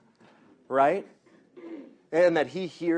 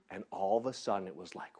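ordinary talk

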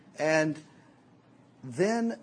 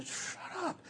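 a sharp unvoiced whisper, higher; hushed shut up; 13 semitones higher, 12 dB quieter than usual. Guys telling a girl to hush, whispered. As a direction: shush her like the guys did. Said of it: "telling me to".

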